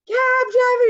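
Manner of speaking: high, full-throated yell down the street; desperate, very loud street call; screaming. Retold or imitated. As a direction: scream for the passing cab driver. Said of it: "I'm screaming".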